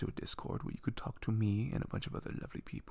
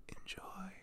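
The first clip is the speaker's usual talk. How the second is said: whisper; 9 semitones higher, 12 dB quieter than usual. Shown as a demonstration. Whispered sign-off before audio.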